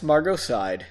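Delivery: usual speaking voice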